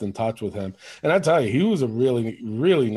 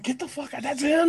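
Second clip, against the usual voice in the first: high, excited no-way; retelling his reaction to the ref's news, pitched high in delight; disbelieving; 13 semitones higher than usual. Retold or imitated. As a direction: toss it out in happy disbelief. Disbelief at friend's fame.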